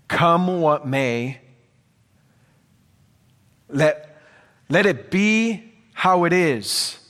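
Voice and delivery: ordinary talk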